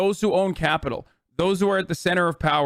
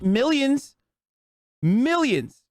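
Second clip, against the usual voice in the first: MILLIONS stretched out, pitch swooping up; emphatic repetition; 7 semitones higher, much slower than usual. Real, not acted. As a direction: say "millions", pause, then "millions" higher and drawn out. Repeats the figure in angry rant.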